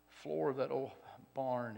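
ordinary talk, nothing marked

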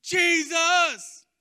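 loud high scream of JESUS. Retold or imitated. Cornered by two attackers, a desperate re-enacted scream. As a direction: scream it out for rescue. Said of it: "I just scream out".

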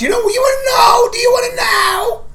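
very high, loud yelping holler; hammed-up hillbilly holler. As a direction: holler it like a carnival barker. Hammed-up comic teaser.

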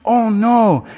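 imitating himself panicking while driving off; retold panic. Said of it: "I panicked again".

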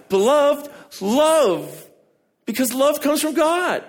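high, loud, forceful proclamation; emphatic preaching. Shown as a demonstration. Pastor proclaiming the two-word command, emphatic. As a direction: drive love home. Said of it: "It's emphatic".

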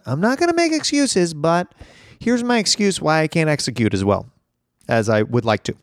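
high-pitched mimicry of making excuses; mock excuse-maker. Podcaster mimics people before their excuse, mock excuse-making. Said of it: "what people say right before they make".

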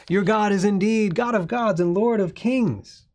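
loud, high royal proclamation; proclaiming king. Retold or imitated. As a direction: declare it like a king praising a god. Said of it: "Nebuchadnezzar says".

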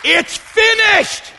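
preacher shouting It's finished; shouted proclamation. Real, not acted. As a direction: cry it out high and drawn out.

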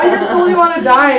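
mock despair. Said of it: "I was like".

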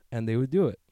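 normal talking voice

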